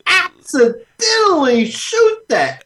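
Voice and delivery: high incredulous squeal; outraged disbelief. Real, not acted. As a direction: squeak it out in horror.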